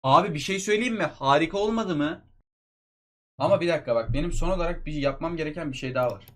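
ordinary talk, nothing marked

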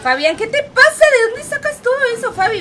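high, loud, fast protest; disbelieving shout. Real, not acted. Streamer yelling at Fabián in disbelief, high loud protest. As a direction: yell at him in disbelief.

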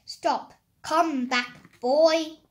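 loud shout, building to the last word; giant's cry. A narrator's reading. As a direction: shout after a fleeing boy. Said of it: "he cried".

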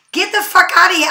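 usual speaking voice

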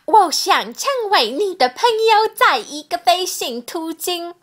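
imitated accent in Mandarin, pitched high; her Chinese accent. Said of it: "I could try in her accent".

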